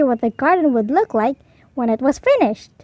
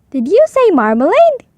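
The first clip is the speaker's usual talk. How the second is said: incredulous; 5 semitones higher, 6 dB louder than usual. A narrator's reading. Foreman in disbelief.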